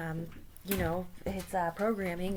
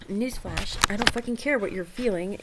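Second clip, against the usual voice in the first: rude chatbot; 9 dB louder than usual. Acted.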